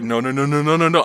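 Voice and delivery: rapid-fire acted panicky refusal; freaking out. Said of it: "I'm freaking out".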